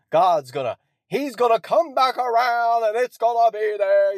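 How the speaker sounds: hammed-up revival promise, mocking; sneering televangelist spiel, high and loud; mocking preacher. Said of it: "he's got this whole spiel".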